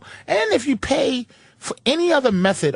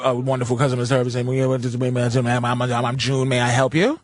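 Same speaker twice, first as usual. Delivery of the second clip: much lower dopey customer service voice; mock phone rep; 9 semitones lower than usual. Mimicking a phone rep to friends, low dopey voice. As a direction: play the bumbling rep, stalling. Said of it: "Here's what I do now".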